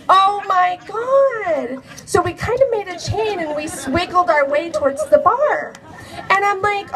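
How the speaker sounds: normal talking voice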